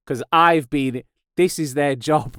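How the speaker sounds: normal talking voice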